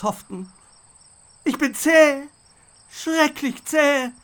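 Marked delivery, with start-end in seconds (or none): 1.4-4.1 much higher and louder, slower defiant yell; the skunk shouts at the crocodiles in the moat, defiant and loud. A narrator's reading. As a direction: bluff loudly that you're too tough to eat. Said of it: "brüllte ich".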